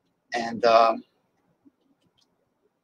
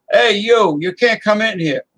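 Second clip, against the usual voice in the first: higher and louder, barking the owner's warning; gruff owner; 7 semitones higher, 4 dB louder than usual. Pretend. Restaurant owner turning a driver away, loud mock warning. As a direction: shoo him out of the restaurant. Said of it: "he's not gonna talk like that".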